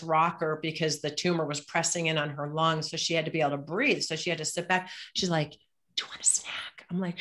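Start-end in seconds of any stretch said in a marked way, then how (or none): 5.5-6.8 breathy whispered offer. Retold or imitated. Frail mother offering her daughter a snack, whisper. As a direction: barely voice the question.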